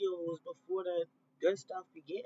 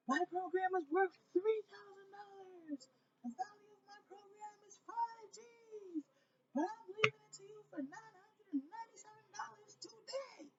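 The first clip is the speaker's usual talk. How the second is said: mock sales pitch; 13 semitones higher, 5 dB quieter than usual. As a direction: ham up a slick online coach selling his program. Mocking a salesy coach pitch.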